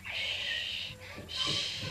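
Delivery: whispered shushing, very soft; secretive whispered shh; hushing. Acted. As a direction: hush like there's a rat nearby. Kittens hushing about the rat.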